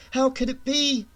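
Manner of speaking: usual speaking voice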